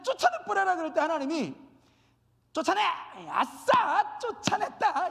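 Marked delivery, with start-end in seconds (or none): 0.0-0.8 high, loud order; mock eager command. Acting eager command to expel. 2.5-5.1 loud, high-pitched triumphant cheer; mock glee. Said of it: "이렇게 신나는 마음으로 하셨을까요".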